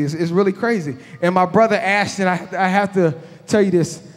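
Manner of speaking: usual speaking voice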